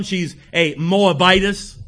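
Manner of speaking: slow, deliberate weight on Moabitess; stress on Moabitess. Retold or imitated. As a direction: stretch out Moabitess so it sinks in. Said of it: "emphasized that word just for this fella to realize".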